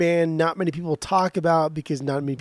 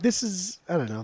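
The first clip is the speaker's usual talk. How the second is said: ordinary talk, nothing marked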